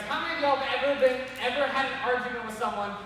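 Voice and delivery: normal talking voice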